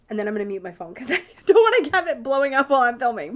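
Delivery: quick, laughing aside. Real, not acted. Telling viewers she'll mute her phone, rushed and laughing. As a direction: giggle through the words as you rush.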